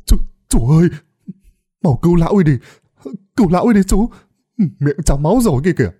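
loud, high frightened pleading; panicked cry. A narrator's reading. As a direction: beg the uncle to save him. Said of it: "kêu lên". (thằng Thạch hoảng sợ kêu lên)

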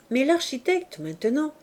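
plain reading voice

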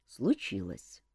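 low, soft sighing answer. A narrator's reading. King confirming bad news to Elmar, low sigh. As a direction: breathe it out heavily. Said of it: "вздохнул тот".

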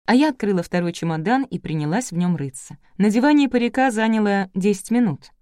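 plain reading voice

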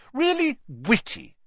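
high, dry scorn; mock them with cutting sarcasm; sarcastic. A narrator's reading. Hermione scorning Pansy's gang, high sarcastic jab. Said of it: "Hermione said sarcastically".

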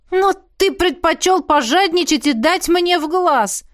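high-pitched wounded protest; indignant. A narrator's reading. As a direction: accuse him in outrage. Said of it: "тут же возмутился эльф".

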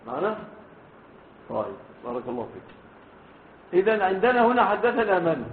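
ordinary talk

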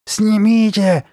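high, loud desperate shout; exploding. A narrator's reading. Girl losing control over her face, explosive shout. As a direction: lose all control and yell it. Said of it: "взорвалась она, потеряв самообладание".

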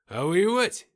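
loud, rising, high-pitched question; indignant. A narrator's reading. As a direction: throw out a heated challenge. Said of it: "возмутился Алексей". (громкий возмущённый вопрос)

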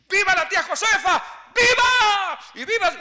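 very high, very loud cheer; festive cheering. Retold or imitated. Cousins cheering the old aunts, loud joyous shout. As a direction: shout it like a party crowd.

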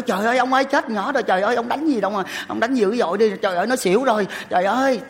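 high, loud frightened wife's cry; panicked mother. Retold or imitated. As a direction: plead in alarm as the wife. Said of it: "Cái má con nói". (vợ hoảng hốt kêu chồng)

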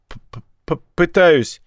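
repeated stuck P, then release; stammered reply. A narrator's reading. Captive major tries to answer the gang's question, stammering. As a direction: catch repeatedly on the first sound before the word.

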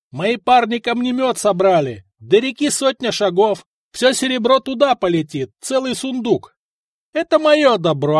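high, carrying shout; defiant shouted declaration. A narrator's reading. Vyshata threatening to sling the chest into the river, shouting. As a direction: proclaim it to the whole crowd below.

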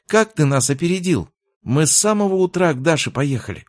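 put-on high-pitched excited child; little girl. Little sister asks boyfriend how he beat them, high child voice. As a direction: pipe up like a puzzled little sister.

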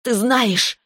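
high, venomous snarl. A narrator's reading. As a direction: spit "знаешь" out high and venomous. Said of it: "прорычала Таис с ядом в голосе".

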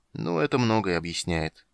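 ordinary delivery

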